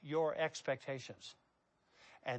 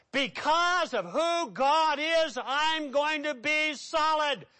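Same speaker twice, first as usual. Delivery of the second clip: high, ringing declaration with weighty stresses; full-force preaching; 8 semitones higher, 7 dB louder than usual. Acted. Preacher vows to be a rock for God, full-force preaching.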